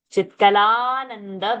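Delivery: "Chitkalananda" slow, long aa after the l; held long vowel. Shown as a demonstration. Teacher showing how to hold dirgha, "Chitkalananda" drawn out. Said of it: "we will have to hold it".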